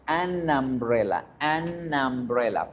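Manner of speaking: "an umbrella" con la ene ligada a una A inicial; enlace según la regla. Shown as a demonstration. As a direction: liga despacio "an umbrella", la U como A. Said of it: "para seguir la regla de la pronunciación". (enlazado, pronunciación modelo)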